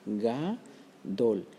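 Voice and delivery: ga-dol split into two clear syllables, "ga" then "dol". Shown as a demonstration. Teacher counting syllables aloud for students, ga-dol split apart. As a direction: pause between "ga" and "dol". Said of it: "la palabra gadol tiene dos sílabas".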